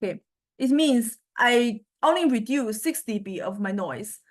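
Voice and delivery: ordinary delivery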